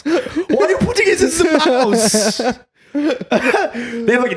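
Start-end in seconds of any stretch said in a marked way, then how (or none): 0.0-2.6 high, slow laughing question; mock outrage dissolving into laughs; laughing disbelief. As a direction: squeak it out through laughter.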